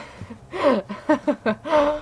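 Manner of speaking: breaking into open laughter; helpless laugh. Real, not acted. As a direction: laugh it off at the absurdity. Laughing at her own rant.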